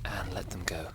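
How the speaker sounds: breathy whispered release; whispering. Acted. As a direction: let the words go on a whisper.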